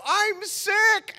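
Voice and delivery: very high, drawn-out wail; panicked mock excuse. Teen refusing to jump in, squealed panicky excuse. Embarrassed excuse repeated before fleeing.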